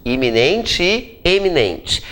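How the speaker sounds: slow "Iminente" with I, then "eminente" with E; contrasting paronyms. Shown as a demonstration. Teacher sets the two words side by side, slowly contrasting I and E. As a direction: separate "Iminente" and "eminente" by their opening vowels. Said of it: "Qual é a diferença".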